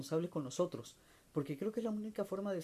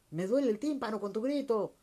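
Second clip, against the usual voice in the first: higher and louder, shouting over the other; shouting complaint; 7 semitones higher, 7 dB louder than usual. Retold or imitated. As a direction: yell it back irritated. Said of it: "empiezo ya a gritar yo al otro". (quejándose a gritos)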